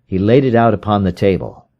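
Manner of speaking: usual speaking voice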